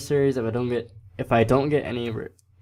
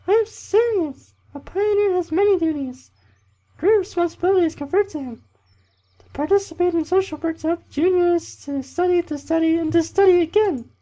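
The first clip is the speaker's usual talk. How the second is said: loud falsetto camp leader reeling off duties; very high, hammed-up female lecture; bad girl voice; 6 dB louder than usual. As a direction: preach earnestly in a badly faked woman's voice. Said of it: "That was a really bad girl voice".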